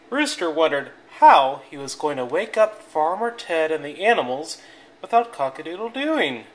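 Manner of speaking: plain reading voice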